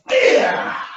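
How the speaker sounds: piercing high karate yell; kiai shout. Acted. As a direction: explode into a full battle cry. Kiai shout ending kata.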